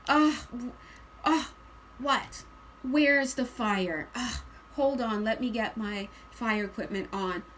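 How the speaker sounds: slow, groaning, put-upon response; reluctant firefighter. Acted. Reader voicing weary firefighter called out, reluctant. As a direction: drag yourself to the job, groaning. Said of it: "you're this firefighter, like".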